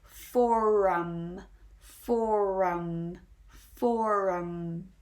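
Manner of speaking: forum stretched, with a rounded R before the ending; strong rhotic R. Shown as a demonstration. Teacher showing the R before finishing the word, strong rhotic forum. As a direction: lean on the rounded R in forum, slowly. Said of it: "really go strong with that rounded rhotic R".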